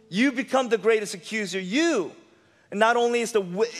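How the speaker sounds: ordinary talk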